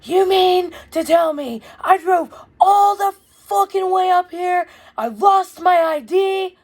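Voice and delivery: high, loud, slow furious yell; screaming at mom. Retold or imitated. Furious son yelling at his mom on the phone, high slow scream. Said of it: "So I'm screaming at her on the phone".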